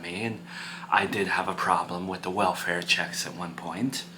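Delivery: low, hushed counsel to an upset son; calm, frank parent. Acted. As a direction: speak softly and low, measured and serious.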